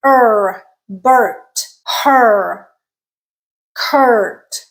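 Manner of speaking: er rounded and tense, with full R; Bert, her, Kurt with strong R coloring; American er sound. Shown as a demonstration. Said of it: "The next one is the er sound".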